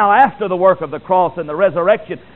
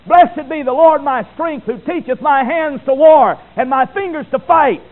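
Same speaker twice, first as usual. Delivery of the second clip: proclaiming psalm; 7 semitones higher, 5 dB louder than usual. Acted. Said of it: "say with the psalmist".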